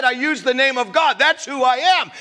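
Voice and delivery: normal talking voice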